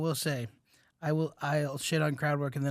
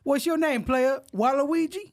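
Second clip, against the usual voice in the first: mock comic voice; 10 semitones higher, 4 dB louder, slower than usual. Mocking the comic together.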